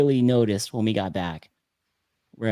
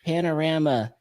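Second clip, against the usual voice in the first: normal talking voice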